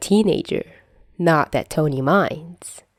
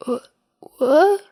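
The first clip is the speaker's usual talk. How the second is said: high, startled stammer; shocked little kid who can't follow; dazed shock; 10 semitones higher, 3 dB louder than usual. Acted. Peter hears about his teeth.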